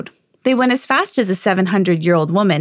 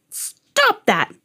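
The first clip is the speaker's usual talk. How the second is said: crying out; 12 semitones higher than usual. A narrator's reading. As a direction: cry out high, mildly indignant. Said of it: "cried the old man".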